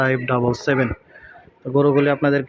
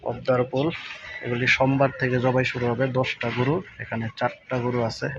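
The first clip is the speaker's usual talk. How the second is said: softer, discreet night-time update; speaking quietly; 3 semitones lower, 7 dB quieter than usual. Real, not acted. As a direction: keep your voice down so you aren't overheard. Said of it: "আস্তে আস্তে কথা বলতেছি, যাতে বা শোনা না যায়". (নিচু গলায় আস্তে আস্তে বলা)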